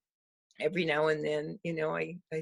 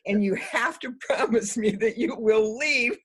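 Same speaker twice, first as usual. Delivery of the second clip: higher, louder quote broken by laughter; laughing retold plea; 4 semitones higher, 5 dB louder than usual. Neighbour making her stand-in promise to leave, retold laughing. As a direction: retell an insistent plea through laughter.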